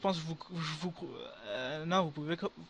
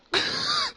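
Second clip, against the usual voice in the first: shrieking laugh; 5 dB louder than usual. Real, not acted. Streamer after a huge drop.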